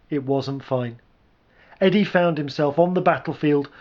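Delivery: plain reading voice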